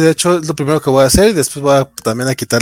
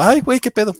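higher-pitched, lightly acted alarm; mock startled reaction; 9 semitones higher than usual. Podcaster acting out being lost without co-hosts, mock startled. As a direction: play someone caught off guard. Acting out someone caught off guard.

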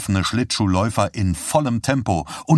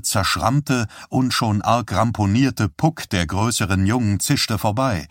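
ordinary delivery

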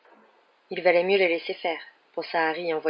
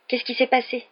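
higher-pitched eager question; impatient; 6 semitones higher than usual. A narrator's reading. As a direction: press for news, wanting it now. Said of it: "dit Ron avec impatience". (question impatiente)

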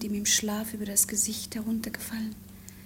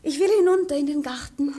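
eifrig; 4 semitones higher, 6 dB louder, faster than usual. Acted. Lena answers eagerly.

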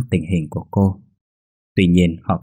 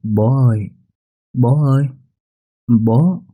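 put-on rising, louder childlike call; child calling dad; 4 semitones higher, 3 dB louder, slower than usual. A narrator's reading. Little son calls his father for the first time, rising high calls. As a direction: call to him like a little boy, each time higher. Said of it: "rụt rè đi đến bên chú khẽ gọi".

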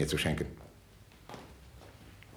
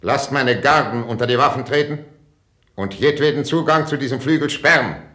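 raised, high, urgent royal command; commanding order; 6 semitones higher, 5 dB louder than usual. Acted. King orders his guards to seal the wing, commanding. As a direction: call out the order forcefully.

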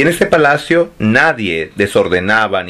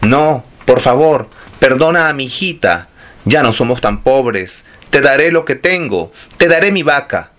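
desperate stammering plea; 5 semitones higher than usual. Acted. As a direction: beg for the child's life, voice climbing. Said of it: "balbuceaba con desesperación la mujer".

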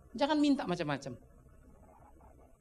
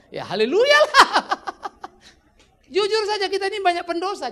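laughing and louder; 12 semitones higher, 11 dB louder than usual. Real, not acted. Preacher laughing to the congregation at his own failing, laughing loud.

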